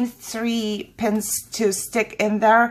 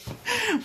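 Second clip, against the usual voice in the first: high giggle; 4 dB quieter than usual. Real, not acted. Laughs at own repetition.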